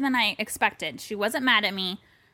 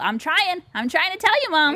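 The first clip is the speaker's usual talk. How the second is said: higher-pitched, mildly exasperated insistence; mock exasperated plea; 7 semitones higher, 3 dB louder than usual. Daughter to mom who won't pick up, exasperated plea. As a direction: plead your case in a raised pitch. Acting out pleading with absent mom.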